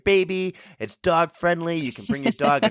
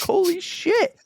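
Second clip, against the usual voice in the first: surprised exclamation; slower than usual. Retold or imitated. Retold shock at connecting dots.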